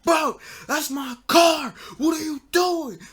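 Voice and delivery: high, loud, drawn-out yell; mad car owner. Retold or imitated. Friend yelling at girlfriend over his smashed car, mock furious. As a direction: imitate an outraged friend yelling. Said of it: "really did make him mad".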